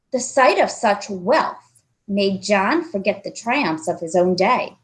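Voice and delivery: usual speaking voice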